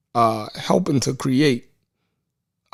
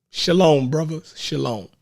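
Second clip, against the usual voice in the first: ordinary talk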